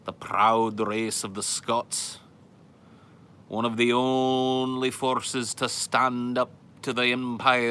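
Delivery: slow, loud, grand mock-Scottish brogue; put-on Scottish accent. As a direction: declaim like a proud hammed-up Scot. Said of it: "Live in Scotland".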